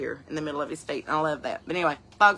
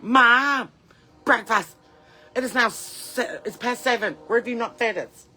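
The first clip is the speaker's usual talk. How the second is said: higher, louder calls for Mom; demanding chickens; 5 semitones higher, 4 dB louder, slower than usual. Acted. Hungry chickens nag Mom for their breakfast, demanding and high-pitched.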